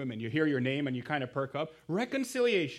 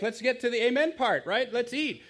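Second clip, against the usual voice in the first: put-on impatient kid; 6 semitones higher, 5 dB louder than usual. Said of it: "He's like".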